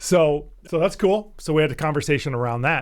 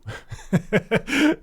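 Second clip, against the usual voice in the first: high laugh; 10 semitones higher than usual. Real, not acted. Laughing at his own story.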